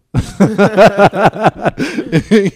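big burst of laughter; high loud laughter. Real, not acted. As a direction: laugh out hard. Laughing at getting fucked.

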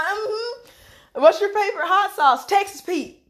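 high sing-song chatter; playful outsider. Retold or imitated. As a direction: play the curious visitor. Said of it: "Just like".

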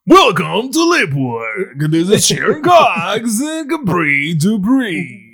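slow, loud radio show intro; radio host voice. Pretend. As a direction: announce it like a hyped radio host. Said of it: "give their radio voice".

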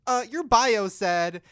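high, loud, slow accusing quote; mock outrage. Friends calling out a hypocritical match, mock outrage. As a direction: drag it out, calling him out.

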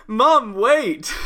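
high, loud call to mom; young man calling to mom. Retold or imitated. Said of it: "which means".

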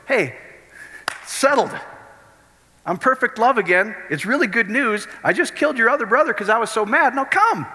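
high, falsely sweet after the killing; mock cheery God. A twisted God sweetly calling his son back, mock cheery. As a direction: sell the murder as good news, sugary.

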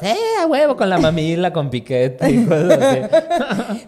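high, slow mock rowdy drinker; rowdy kid voice. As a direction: play a boozy kid cheering. Mocking Sinaloa toddler drinking.

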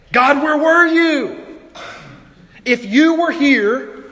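high, loud, slow cry of hurt; slow pained outcry at God; anguished plea. Pretend. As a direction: plead with God through pain. Pained cry of grieving believers.

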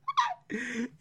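high squealing laugh; helpless high-pitched laugh; shrieking laugh. Real, not acted. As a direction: burst into a shrill laugh. Huge laugh.

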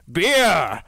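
put-on one-word order in mangled Fellish accent; bad Fellish accent. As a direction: call out in a badly attempted Fellish accent. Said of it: "attempting to be a bad Fellish accent".

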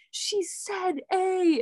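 high, drawn-out imitation of an indignant class; mock group protest. Whole class talking back to the teacher, mock indignant protest. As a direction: stretch each word out high, like a class protesting.

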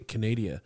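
"Canada" said as kuh-NAY-dee-uh; joking mispronunciation. Pretend. Host fumbling the country north of us, joking mispronunciation. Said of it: "it's the country north of us".

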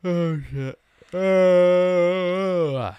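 yawning through the words. Real, not acted. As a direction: talk mid-yawn. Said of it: "You're very yawn".

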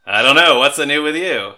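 loud playful silly voice; loud goofy voice. Pretend. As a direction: ham it up loudly as a joke. Jokey loud comeback voice.

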